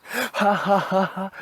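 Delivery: hammed-up jolly laugh while patting the belly; imitating a salesman's fake belly laugh. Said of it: "he'd rub his belly and be like".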